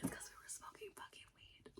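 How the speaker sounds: barely audible whispered confession; hushed, voiceless admission of a secret; confiding whisper. Real, not acted.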